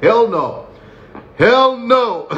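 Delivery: high, emphatic "Hell no", repeated with rising force; indignant refusal. Retold or imitated. Comedian to audience, recoiling from a prison invitation, high indignant refusal.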